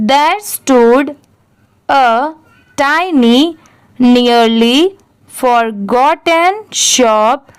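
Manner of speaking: very slow, broken into short chunks; read for repetition. Shown as a demonstration. Teacher dictating a paragraph for learners to repeat, very slowly. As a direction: stretch each word and pause for repetition. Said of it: "अब जो मैं पढ़ूंगी मेरे बाद आप भी repeat करिए".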